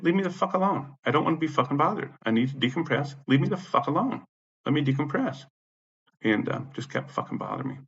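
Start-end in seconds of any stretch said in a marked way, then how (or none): 0.0-5.4 high-pitched, worked-up pleading anger; retold exasperated demand. Tenant telling manager to leave him be, exasperated. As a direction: re-enact demanding space, voice pitched up.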